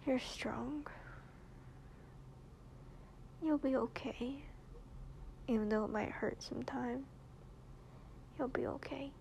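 soft, tender whispered comfort; reassuring whisper. Real, not acted. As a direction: whisper it gently to comfort her. Comforting partner before leaving.

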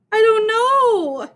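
high, drawn-out wail; sobbing, acted. A narrator's reading. Dana answering Myron about her hurt dog, acted sobbing. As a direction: wail it slowly like a distraught child. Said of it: "Dana sobbed".

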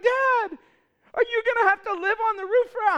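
put-on high, quick, panicky child voice; panicky kids. Kids worried their dad is stuck on the roof, high and panicky. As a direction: squeak it out like a scared little kid. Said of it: "They're like".

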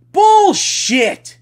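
loud, high shout. Real, not acted. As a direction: yell it at them. Shouting down the complaint.

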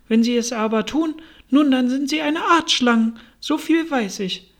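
high, emphatic verdict; adamant pigeon. A narrator's reading. Pigeon classes egg-eating girls as snakes, adamant.

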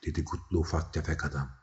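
usual speaking voice

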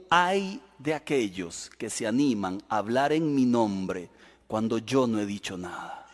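God answering false prophets, low, soft and slow. Acted. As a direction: speak as a grave, quiet God. God's reply voiced.

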